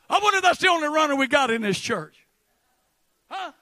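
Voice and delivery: usual speaking voice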